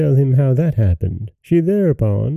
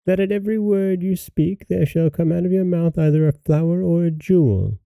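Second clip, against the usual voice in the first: higher, measured fairy voice; pitched up like a storybook fairy; gracious fairy; 7 semitones higher, 3 dB quieter than usual. A narrator's reading. Fairy granting the pretty girl a gift, higher voice.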